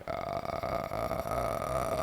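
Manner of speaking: low, croaky vocal fry groan. Retold or imitated. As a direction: drag it out in deep fry. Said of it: "Nice bit of vocal fry".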